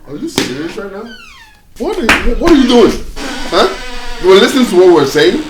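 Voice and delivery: angry outburst. Acted. Son angrily confronts the girl listening at the door. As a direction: call out the snooping.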